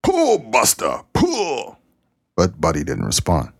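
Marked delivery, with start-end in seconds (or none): 0.0-1.8 high, drawn-out mock holler, the first pull stretched slow; farmer hollering. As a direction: stretch out the first pull, then yell the second one high. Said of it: "the farmer hollered".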